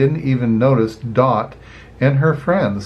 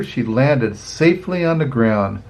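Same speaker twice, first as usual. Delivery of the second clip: plain reading voice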